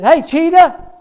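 high, booming holler; roaring lion. Acted. Lion hailing a passing monkey, roaring shout. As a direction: yell it like a lion roaring up a storm. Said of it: "just a roaring up a storm".

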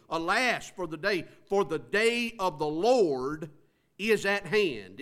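slow, loud weight on DAY and LORD; emphatic exclamation, leaning on ALAS, DAY, LORD; big-deal emphasis. Shown as a demonstration. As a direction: proclaim "Alas" and "Lord" as momentous, stretching them out. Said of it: "it's a big deal here".

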